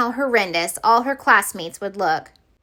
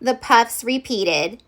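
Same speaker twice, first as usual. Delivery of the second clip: usual speaking voice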